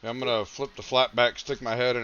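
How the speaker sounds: ordinary delivery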